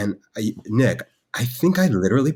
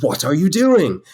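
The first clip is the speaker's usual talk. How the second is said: attempted English accent, higher and louder; 6 semitones higher, 5 dB louder than usual. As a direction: put on an English accent in indignant question. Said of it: "trying to do a decent English accent".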